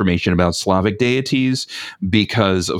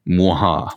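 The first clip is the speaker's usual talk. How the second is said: mustache twirling villain chuckle; short evil mwaha; villain laugh. Acted. Evil laugh after teasing.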